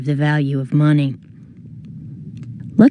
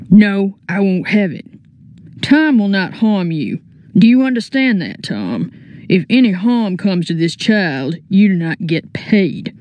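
a hint of a stern outlaw boss; firm, measured outlaw leader; lightly voiced bandit chief; 3 semitones higher than usual. Bandit chief gives orders.

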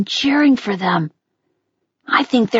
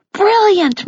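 high-pitched, moved praise; emotional; 9 semitones higher than usual. A narrator's reading. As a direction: praise as if overcome with feeling. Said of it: "She was honestly so emotional she could barely".

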